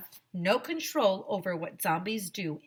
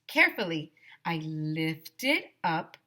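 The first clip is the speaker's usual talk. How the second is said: ordinary delivery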